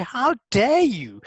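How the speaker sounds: high, very slow How dare you, the bloody dropped; a prim, stretched-out outrage; mock-polite offended voice; polite indignation. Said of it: "That is me being polite".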